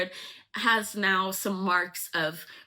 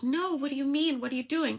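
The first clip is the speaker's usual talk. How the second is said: high-pitched mock panic; acted pleading disbelief; distressed protest, retold; 8 semitones higher than usual. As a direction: protest in a much higher voice, as the jilted lover. Rejected lover's acted protest.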